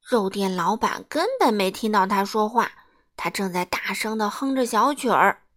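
ordinary delivery

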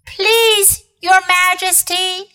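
high, pleading fox voice; timid fox. A narrator's reading. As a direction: beg humbly like an old fox. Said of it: "said the fox".

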